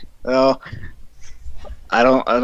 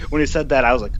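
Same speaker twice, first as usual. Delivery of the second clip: ordinary delivery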